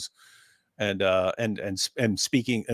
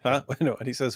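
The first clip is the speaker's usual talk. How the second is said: usual speaking voice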